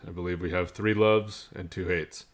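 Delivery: ordinary talk, nothing marked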